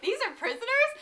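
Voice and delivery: high rising, unsure "prisoners?"; unsure question. Retold or imitated. Droid answering a stormtrooper with a nervous question. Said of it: "he says it as, like, a question".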